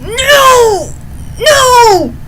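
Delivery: high, loud repeated protest; anguished shout. Retold or imitated. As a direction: cry out like a fan watching disaster. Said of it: "I'm like".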